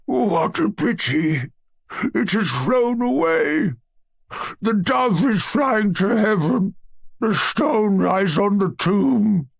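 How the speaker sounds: high, slow, faraway wail of a holy fool; muttering holy fool. A narrator's reading. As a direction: drift off into a pious lament, slowly. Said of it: "as he muttered to himself".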